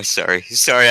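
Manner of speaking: ordinary delivery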